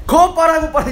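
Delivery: high, loud told-you-so jeer; mocking neighbour crowing over Job; gloating taunt.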